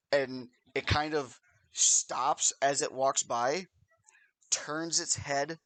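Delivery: ordinary talk, nothing marked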